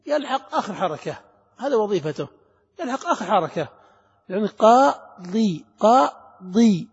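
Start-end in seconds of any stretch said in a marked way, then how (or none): 4.5-6.9 "قاضي" slow and split, qaa... dii; split syllables. Shown as a demonstration. Teacher building the word before adding tanween, qaa-dii split slowly. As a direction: stretch "قاضي" into two separate long syllables. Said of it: "ثم هات التنوين نون ساكنة".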